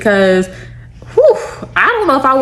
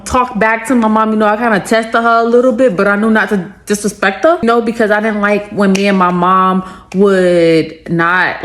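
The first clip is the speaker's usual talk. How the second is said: ordinary talk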